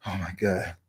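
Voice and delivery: low, hushed muttering, half to himself; embarrassed mutter. Retold or imitated. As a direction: mutter it like you're mortified.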